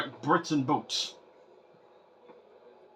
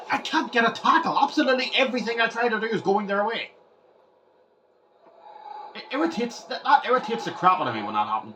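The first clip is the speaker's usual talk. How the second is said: higher, fast exasperated complaint; frustrated rant; 6 semitones higher, faster than usual. Real, not acted. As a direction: rant at the game. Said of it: "stop ranting".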